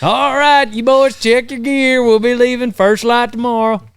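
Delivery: loud, high cowboy captain voice; western movie quote. Acted. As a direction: bark it like a trail boss. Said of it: "Name that movie".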